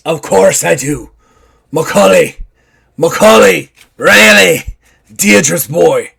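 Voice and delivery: put-on old pirate voice. Simon Grimes stopping a fight, in a loud pirate voice. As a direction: scold him like a salty old sea dog. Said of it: "Grimes looks at me and goes".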